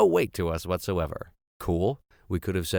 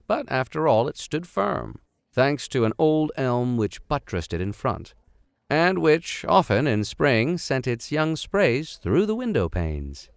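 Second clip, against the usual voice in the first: measured storybook reading voice; story narration; 4 semitones higher than usual. A narrator's reading. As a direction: read it like a classic adventure novel. Reads novel aloud as narrator.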